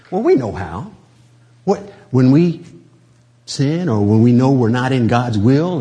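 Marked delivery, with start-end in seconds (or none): none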